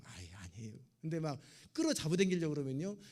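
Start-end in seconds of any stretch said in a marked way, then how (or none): 0.0-0.8 bashful demurral. Retold or imitated. Flattered person modestly declining praise.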